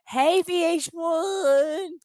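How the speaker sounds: very high, slow, sing-song hello; mock happy greeting. Guest waving to VH1 camera, high stretched gushing hello.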